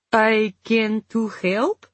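put-on slow, heavy Polish accent. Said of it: "Ivona said in a heavy Polish accent".

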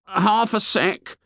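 put-on slow, high-pitched boyish voice; fat boy's voice. A narrator's reading. Piggy excuses himself to Ralph, slow high boy voice.

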